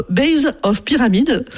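slow, careful English term in a French accent. Real, not acted. Lecturer self-mockingly says English term, French accent. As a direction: say base of pyramid slowly, French accent. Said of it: "avec mon super accent".

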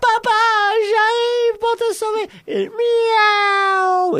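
very high, drawn-out heroic cry; chaton sauveteur. Acted. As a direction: squeal it out while leaping, ending in a meow. Chaton saute au secours de papa. (voix suraiguë de chaton qui s'élance)

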